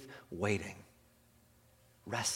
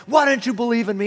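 mock lecture; 9 semitones higher, 10 dB louder, faster than usual. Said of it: "He doesn't lecture him and say".

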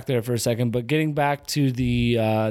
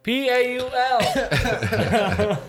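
drawn-out, high-pitched mock yell; yelling a name; 8 semitones higher, much slower than usual. Imitating friend calling for Paul on the trail, mock yelling. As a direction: spell it out long and high, like a distant call. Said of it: "I just started yelling Paul's name".

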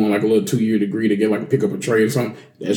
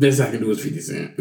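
lowball pawn dealer. Retold or imitated. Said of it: "That didn't sound like something".

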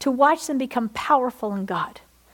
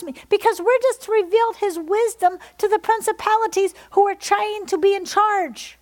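fiery preaching; 9 semitones higher than usual. Acted. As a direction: preach it out to the congregation. Preaching at full force continues.

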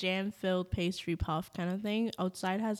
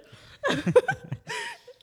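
high laugh; 5 dB louder than usual. Real, not acted. Laughing at her own joke.

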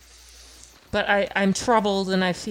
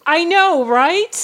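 loud; 8 semitones higher, 10 dB louder, slower than usual. Acted. Loud in-character agreement.

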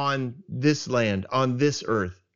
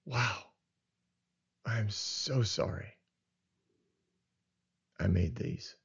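sorrowful God; 5 semitones lower than usual. Retold or imitated. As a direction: say it gently, heartbroken over what's coming. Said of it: "the Lord looks at the world and says".

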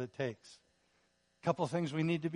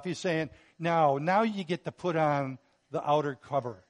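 louder, driving sermon on love; full-force preaching; 6 dB louder than usual. Acted. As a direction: preach it out to the congregation.

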